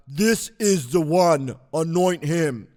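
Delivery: slow, booming God voice; God's voice. Acted. The Lord telling the man of God whom to anoint, booming. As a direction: proclaim it grandly as God, drawn out. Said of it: "I love doing that".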